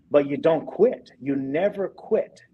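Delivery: slow, weighty insistence; slow emphatic. Real, not acted. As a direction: lean on each word slowly. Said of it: "emphatic life lesson".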